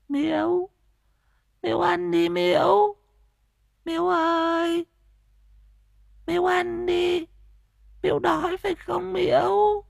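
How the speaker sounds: put-on a simple girl pleading gently, torn between a smile and a sob; simple-minded girl, half laughing half crying. A narrator's reading. Mad girl coaxing old Miễu to eat, tearful and childlike. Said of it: "mếu máo, dở cười dở khóc".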